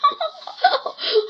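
high gleeful cackle of a hungry wolf; a delighted villain's laugh, high up. Acted. Wolf hears of child to eat. (大灰狼高声得意地笑)

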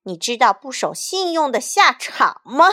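loud warning to the mayor. Acted. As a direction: demand it loudly and threateningly. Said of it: "他大声说".

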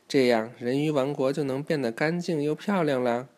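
usual speaking voice

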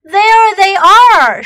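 loud, high, slow shout. Acted. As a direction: call out and point. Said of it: "shouted a man".

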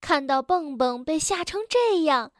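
usual speaking voice

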